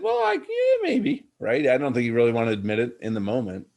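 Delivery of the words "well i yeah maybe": much higher mock voice of an evasive son; reluctant teen son. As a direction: squeak it out like a teenager dodging the point.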